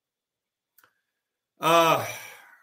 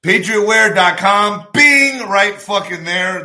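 loud, slow hype shout; hyped plug; 3 semitones higher, 9 dB louder, slower than usual. Acted. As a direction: sell it like a hype man.

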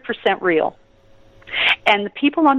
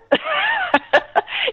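high laugh. Real, not acted. Laugh after whoop.

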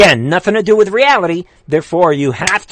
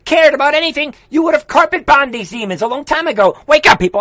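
indignant, high-pitched outburst; really riled and insistent; worked up; 9 semitones higher than usual. Real, not acted. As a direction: rant with your pitch pushed up. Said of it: "I don't wanna get worked up".

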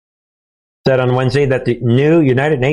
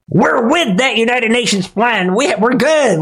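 much higher, gleeful mock voice; mock smug official; 9 semitones higher than usual. Voicing Saudi officials mocking the UN plan, gleeful and high. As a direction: brag with fake cheer.

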